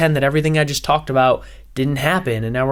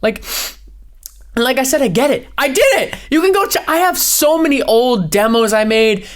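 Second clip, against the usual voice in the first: ordinary talk, nothing marked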